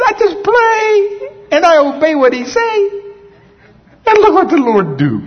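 the same Puerto Rican imitation, now with a crying catch on "I just pray"; Puerto Rican accent. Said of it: "I'm trying to speak in Puerto Rican accent".

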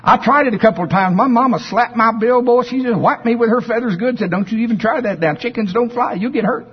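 high, fast chicken protesting. Acted. Chicken Chuck tells the teacher duck he can't fly, high voice.

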